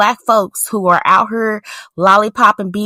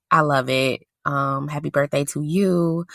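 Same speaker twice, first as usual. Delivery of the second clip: ordinary talk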